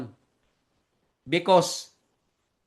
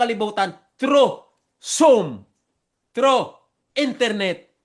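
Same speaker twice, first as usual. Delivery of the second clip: "through Zoom, through internet" punched louder and higher; emphasized; 4 semitones higher, 4 dB louder than usual. Real, not acted. Preacher stressing to viewers that Zoom reaches the world, emphatic. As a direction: hammer home "through" each time. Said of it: "gusto ko i-emphasize".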